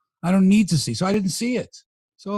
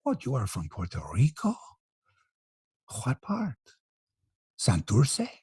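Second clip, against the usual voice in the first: soft Puerto Rican lilt, impersonated; Charlie Montoyo; 4 semitones lower, 7 dB quieter than usual. Pretend. Imagined local mistaking host for a native, Puerto Rican accent. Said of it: "speak in the same lilt as Charlie Montoyo".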